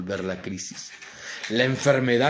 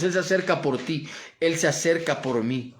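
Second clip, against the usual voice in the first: ordinary delivery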